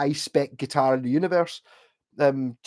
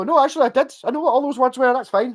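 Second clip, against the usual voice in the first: Kent local; 9 semitones higher, 5 dB louder than usual. Retold or imitated. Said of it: "And they go".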